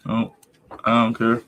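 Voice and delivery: ordinary delivery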